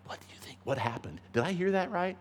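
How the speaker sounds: breathy unvoiced whisper of doubt. Ladies doubting among themselves on the way, whispered. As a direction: whisper it as if conferring in secret.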